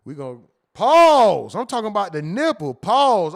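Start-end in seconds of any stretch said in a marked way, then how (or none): 0.7-1.5 slow, loud shout of pause; sudden loud self-correction; loud pause call. Real, not acted. Catches himself after a crude phrase. 2.7-3.3 short loud shout of pause; loud self-correction; loud pause call. Real, not acted. Repeats pause call loudly.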